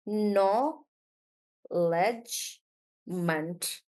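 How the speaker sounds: acknowledgement drawn out very slowly, syllable by syllable; slow syllable split. Shown as a demonstration. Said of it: "So I'll do".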